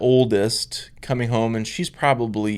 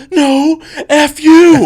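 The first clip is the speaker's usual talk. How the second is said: put-on much higher, louder kid voice cracking into laughter; squeaky kid shout; 15 dB louder, much slower than usual. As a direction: shriek it like a defiant little girl. Retelling daughter cussing the bully.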